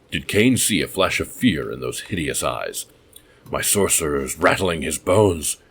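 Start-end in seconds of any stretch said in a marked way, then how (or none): none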